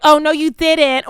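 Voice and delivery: high, slow, put-on sassy voice; mock sassy voice. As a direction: mimic a bad sassy impression. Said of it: "putting on some weird accent".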